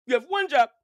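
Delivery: loud, high scolding shout. Real, not acted. Scolding the girl about listening, shouted. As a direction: bark the rule at them.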